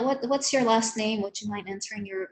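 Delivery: ordinary talk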